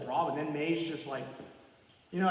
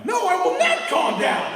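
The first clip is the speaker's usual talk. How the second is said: high, loud furious refusal; slow, loud, over-the-top defiance; angry shout; 14 semitones higher, 10 dB louder than usual. Retold or imitated. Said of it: "Peter just is like".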